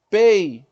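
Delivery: "Pei" numa sílaba só, forte; E e I grudados em "Pei"; ditongo junto. Shown as a demonstration. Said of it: "o E e o I ficaram juntos na mesma". ("Pei" forte, numa batida só)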